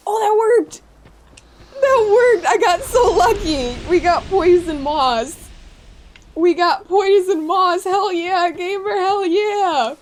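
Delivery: loud, high, stretched-out cheering; thrilled celebration. Real, not acted. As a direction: crow over a lucky win, loud and elated. Lucky kill and loot in game.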